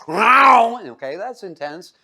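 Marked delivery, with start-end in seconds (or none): 0.1-0.8 loud hammed-up meow for a lion's roar; mock lion roar. Preacher voicing a lion at the front door, mock roar. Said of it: "there's a lion going".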